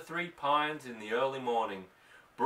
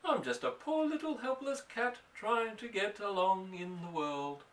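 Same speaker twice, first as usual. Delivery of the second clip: high, pitiful cat voice; pitiful little cat; 6 semitones higher than usual. Acted. Mother voicing the cat's self-pity, high and pitiful. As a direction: plead like a poor helpless cat.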